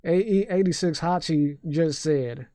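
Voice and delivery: slow Southern drawl; unhurried Southern-accented announcement. Shown as a demonstration. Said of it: "read that in a Southern accent".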